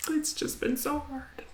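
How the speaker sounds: tearful, high, slow whisper breaking apart; on the edge of tears, barely holding it. Real, not acted. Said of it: "I'm sorry".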